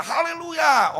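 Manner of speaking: high, loud hallelujah cry; imitated full-voiced exultant shout; shouted praise. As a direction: yell it out as if across a mall. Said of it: "teriak di mall dan berkata".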